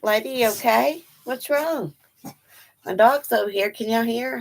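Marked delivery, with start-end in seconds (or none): 0.0-1.9 soft, higher-pitched voice to a dog; concerned pet talk. Real, not acted. Streamer checking on her huffing dog, soft and concerned. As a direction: check on the dog, high and gentle.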